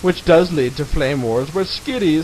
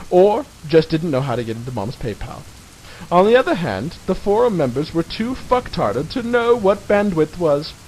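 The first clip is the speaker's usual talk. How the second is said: usual speaking voice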